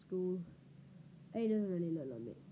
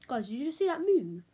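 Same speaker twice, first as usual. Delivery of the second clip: louder, higher, startled; 4 semitones higher, 9 dB louder than usual. Real, not acted. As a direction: call it out in alarm. Startled by something moving.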